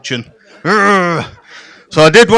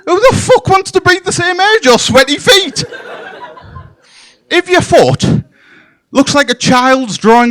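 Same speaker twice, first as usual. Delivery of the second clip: indignant; 9 semitones higher than usual. Acted. Indignant rant at sandal wearers.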